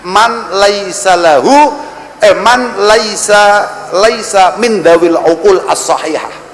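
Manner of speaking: very slow, drawn-out Arabic recitation. Shown as a demonstration. Preacher quoting scripture to the crowd, slow recitation. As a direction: lay out each Arabic word slowly. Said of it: "reciting Arabic slowly".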